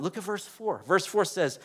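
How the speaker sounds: ordinary talk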